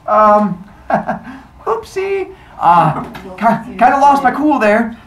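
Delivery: high, awkward, with nervous giggle; sheepish excuse. Acted. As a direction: cover up with an embarrassed little laugh. Embarrassed goddess excuses herself.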